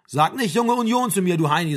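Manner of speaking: mildly heated quarrel voice; indignant retort. Acted. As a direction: throw the insult back, affronted. Acted quarrel, insulted Frank snaps back.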